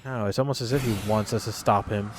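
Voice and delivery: usual speaking voice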